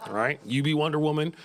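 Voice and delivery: usual speaking voice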